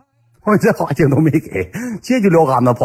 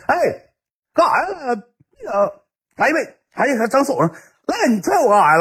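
high-pitched, hammed-up whine; mock yelping protest; 13 semitones higher than usual. Kicked friend complaining to his attacker, high mock whine. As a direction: squeal as the hapless victim.

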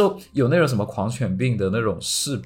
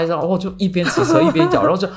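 higher and louder, laughing through the words; 7 semitones higher, 4 dB louder than usual. Retold or imitated. Telling friends about the dog biting while biking, laughing. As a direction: giggle as you describe the dog biting.

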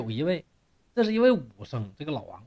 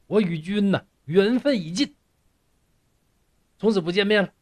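raised, louder voice, breaking it off for good; angry farewell; 4 semitones higher, 6 dB louder than usual. A narrator's reading. Girl angrily ending things with Yang, louder and raised. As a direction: cut him off coldly and loudly. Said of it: "女孩很生气".

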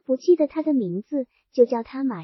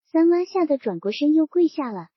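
usual speaking voice

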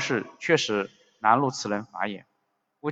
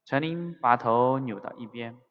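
ordinary delivery

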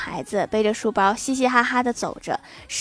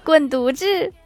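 mock angry retort; 5 semitones higher, 3 dB louder, slower than usual. Wife snaps back at insult.

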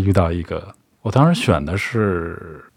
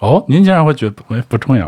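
taken aback, higher-pitched reaction; genuinely surprised; 5 semitones higher than usual. Real, not acted. Host hears the guest say it may not matter, surprised. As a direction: pitch jumps up in real surprise. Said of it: "哎，我还挺意外的".

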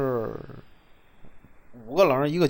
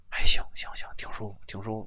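hushed reply; 6 semitones lower than usual. Retold or imitated. The supervisor being bled tells the narrator it feels good, in a near whisper. Supervisor answers during bloodletting.

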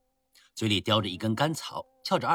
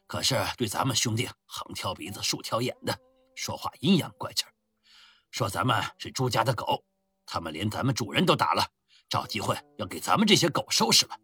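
muffled booming voice. Acted. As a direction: speak thick and hollow from the chest. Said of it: "瓮声瓮气地开口说道". (瓮声瓮气的低沉嗓音)

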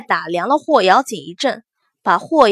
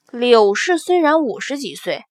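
ordinary delivery

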